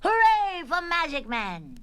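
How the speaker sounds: high, loud, stretched hooray; cheering. Acted. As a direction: cheer like a jubilant child. Children cheer Magic Man.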